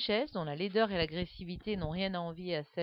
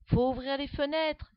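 exclaiming; 7 semitones higher, 4 dB louder than usual. A narrator's reading. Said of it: "s'exclame Guillaume".

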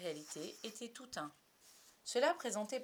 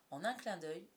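plain reading voice

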